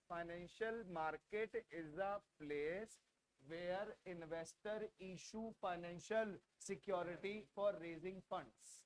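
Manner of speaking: very slow, low and soft dictation. Shown as a demonstration. Teacher dictating a definition to students, very slowly. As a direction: spell out the sentence word by word, hushed and unhurried. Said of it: "बहुत धीरे धीरे बोल रहा हूँ".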